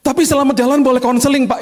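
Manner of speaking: very high, loud pleading driver voice. Retold or imitated. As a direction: beg like the desperate driver. Driver begging for counseling. (sopir memohon dengan suara sangat tinggi)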